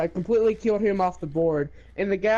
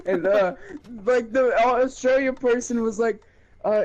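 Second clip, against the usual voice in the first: usual speaking voice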